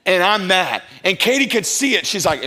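ordinary talk, nothing marked

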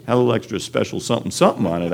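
ordinary delivery

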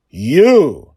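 loud, high accusing shout. A narrator's reading. Space pirate confronting the girl at his door, loud shout. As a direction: bark it out at the intruder. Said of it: "he shouted".

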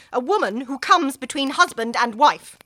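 a bitter, loud denunciation; angry accusation. Acted. Wife denouncing the woman she blames, loudly.

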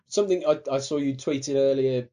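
normal talking voice

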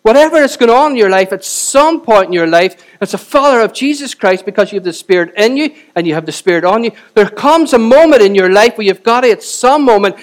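rally the church like a revival preacher; preaching at full force. Acted. Preacher urging the congregation to stand up in faith, preaching at full force.

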